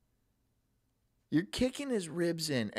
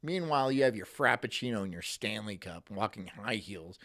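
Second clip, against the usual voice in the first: normal talking voice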